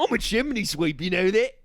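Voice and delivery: cartoonish English chimney sweep; broad, hammed-up Cockney; Cockney accent. London chimney sweep boasting to a passenger, cartoon Cockney. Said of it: "You sound like Wallace and Gromit".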